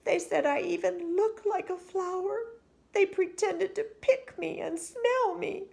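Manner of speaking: put-on high, small girl's complaint; unhappy little girl. A narrator's reading. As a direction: sound like a hurt child. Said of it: "said Chrysanthemum".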